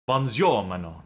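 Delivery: put-on hammy French accent reading a mission briefing; over-the-top French accent, fully committed. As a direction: play it like a French Resistance handler. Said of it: "This is my French accent".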